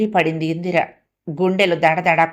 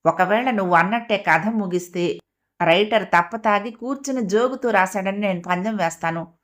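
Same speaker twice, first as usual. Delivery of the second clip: ordinary delivery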